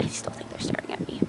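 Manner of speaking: fast nervous whisper. Real, not acted. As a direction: whisper it so they can't hear. Watched by people in truck.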